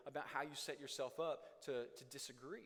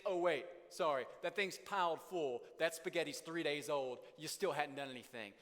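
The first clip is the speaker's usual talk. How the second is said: louder, biting mock criticism; sarcastic complaint; 7 dB louder than usual. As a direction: jab at the spouse about the dishes.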